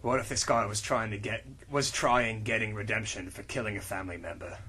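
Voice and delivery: low, quiet, fast brooding hero; low, soft, quick tough-guy read; John Constantine voice. Acted. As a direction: mutter it like a weary detective. Reads character lines in low voice.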